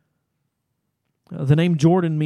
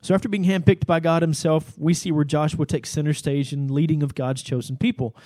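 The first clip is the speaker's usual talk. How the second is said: usual speaking voice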